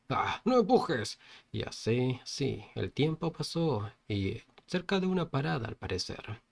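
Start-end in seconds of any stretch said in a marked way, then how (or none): 0.1-1.2 raised pitch, slow mock shout; mild narrated yell. Crowded passenger protesting at being shoved, mild slow yell. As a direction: protest without really shouting. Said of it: "el otro le gritaba".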